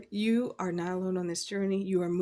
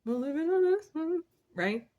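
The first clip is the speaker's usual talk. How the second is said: excited; 8 semitones higher than usual. Real, not acted. Cheering about living best life.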